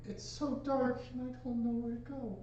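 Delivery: put-on high frightened child voice; lost child. Game master voicing a crying child in the woods, frightened. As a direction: plead like a lost kid in the dark. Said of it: "You start hearing".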